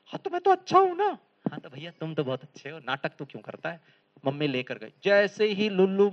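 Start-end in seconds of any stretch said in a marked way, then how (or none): 0.1-1.2 put-on high, childish protest. Little son insists to mother that he is good. 1.5-4.0 lower, softer teasing reply; gently mock the boy's claim; mother's reply. Mother quietly teases her son.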